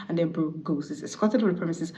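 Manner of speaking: ordinary talk, nothing marked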